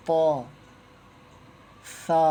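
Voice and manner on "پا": پا said heavy and full-mouthed; thick pa. Shown as a demonstration. Teacher shows students a heavy پا. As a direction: read پا thick and round. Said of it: "موٹا کر کے پڑھنا ہے".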